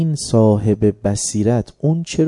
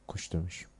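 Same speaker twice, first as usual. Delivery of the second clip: much softer, calm acceptance; quiet resigned reply; 4 semitones lower, 17 dB quieter, faster than usual. Acted. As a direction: let it fall away softly, accepting fate. Imam calmly accepts death.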